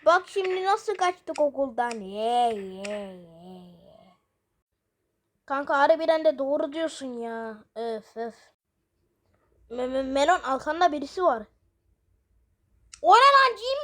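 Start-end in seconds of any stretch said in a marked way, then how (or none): 1.9-3.7 creature munching. Acted. Creature eating, odd voice. 13.0-13.8 higher, louder startled yell; startled shout. Acted. Sees something behind friend.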